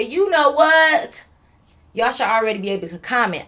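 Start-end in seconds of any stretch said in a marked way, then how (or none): 0.0-1.2 high, loud and very slow; drawn-out catchphrase. Shown as a demonstration. Vlogger cueing viewers to finish her line, drawn-out catchphrase. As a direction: stretch it out as a cue to finish. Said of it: "When I say".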